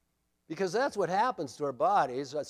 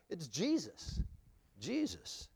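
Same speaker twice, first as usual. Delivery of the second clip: hushed answer; 9 dB quieter, slower than usual. Real, not acted. Preacher answers his own question.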